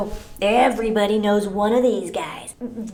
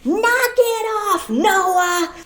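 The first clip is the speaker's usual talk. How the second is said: high, slow, louder scold; scolding call; 9 semitones higher, 3 dB louder, much slower than usual. Pretend. Shoppers scold a screaming kid, high slow scold. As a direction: snap the new name at the kid.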